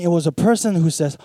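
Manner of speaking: ordinary delivery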